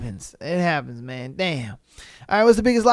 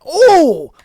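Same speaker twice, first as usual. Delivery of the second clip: high, loud swooning delight; smitten; 6 semitones higher, 5 dB louder, much slower than usual. Retold or imitated. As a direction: gush over the girl you like. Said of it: "You're like".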